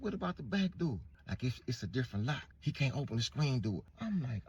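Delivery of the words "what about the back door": high, anxious question; looking for a way out; panicked. Retold or imitated.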